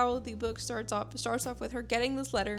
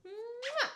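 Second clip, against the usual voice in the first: high, soft smacking kiss; affectionate mwah; kiss sound; 11 semitones higher, 5 dB quieter than usual. Real, not acted. Kissing her dog.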